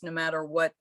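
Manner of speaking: ordinary talk, nothing marked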